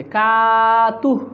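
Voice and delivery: কাতুহ with the কা held one alif long; slow lengthened কাতুহ; stretched vowel. Shown as a demonstration. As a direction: draw out কা slowly. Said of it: "এক আলিফ টান আছে".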